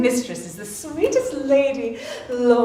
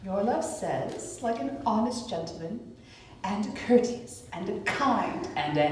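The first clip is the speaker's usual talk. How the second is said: teasing; 4 semitones lower, 9 dB quieter than usual. Acted. Nurse teasingly drags out Romeo's answer.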